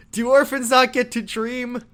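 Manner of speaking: high yelled plea; screaming. Retold or imitated. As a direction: cry it out to the sky. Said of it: "he screams that at the heavens".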